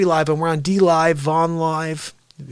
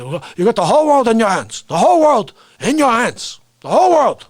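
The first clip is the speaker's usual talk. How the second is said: loud, hammed-up political impression, climbing higher; Bernie; 9 semitones higher, 11 dB louder than usual. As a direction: belt it out like a shouting old senator. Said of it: "'cause Bernie had to say".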